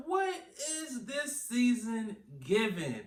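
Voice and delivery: high, drawn-out incredulous question; exasperated retold reaction. Reacting to the season for viewers, high exasperated question. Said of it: "I'm just like".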